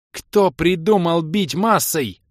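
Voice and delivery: high, loud, slow angry yell; furious shout. A narrator's reading. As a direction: shout it out in rage. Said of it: "яростно закричала Кира".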